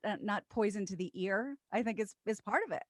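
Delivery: usual speaking voice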